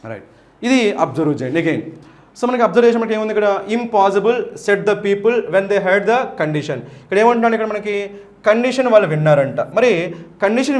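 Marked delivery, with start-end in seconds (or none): 3.8-6.8 slow, careful reading. Shown as a demonstration. Teacher reads the new passage slowly to students. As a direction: read the line slowly for the class.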